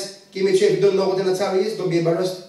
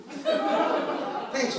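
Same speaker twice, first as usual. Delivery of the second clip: usual speaking voice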